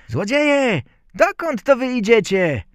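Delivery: loud, high-pitched call; tiny boy hailing the thieves; Bździelik. A narrator's reading. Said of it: "i zawołał". (głośne, wysokie wołanie malca)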